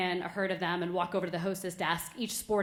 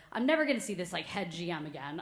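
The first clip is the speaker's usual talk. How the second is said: normal talking voice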